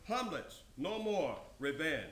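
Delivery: ordinary delivery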